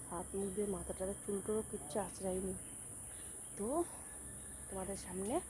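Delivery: soft, hushed vlog talk; speaking softly. Real, not acted. Vlogger keeping her voice down while showing the garden, soft. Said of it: "আমি না আস্তে আস্তে কথা বলছি".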